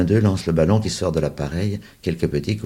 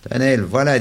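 put-on teasing big boy; 8 semitones higher, 7 dB louder than usual. A narrator's reading. Big boy teasing the small ones.

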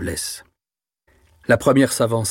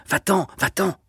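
higher, urgent mild stammer; stammered plea; 8 semitones higher than usual. A narrator's reading. Frightened sister chasing Jean away, mildly panicked stammer.

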